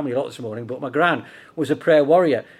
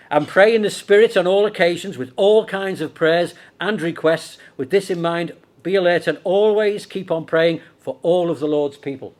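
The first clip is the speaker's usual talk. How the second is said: emphatic, raised-voice Bible reading; scripture reading; 2 semitones higher, 5 dB louder than usual. Acted. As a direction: read the verse out loud and punchy to the congregation. Reads the verse aloud.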